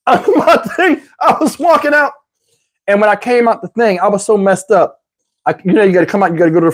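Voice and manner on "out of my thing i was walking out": high, laugh-filled talking; words squeezed through laughter; real laughing. Real, not acted. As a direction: keep telling it while cracking up. Cracks up at own story.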